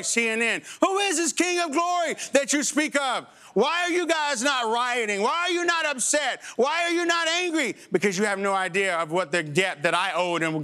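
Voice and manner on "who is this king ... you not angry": high, scornful mock questions; mocking skeptic. Preacher mimics the world asking why he is not angry. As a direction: needle them with pitched-up whys.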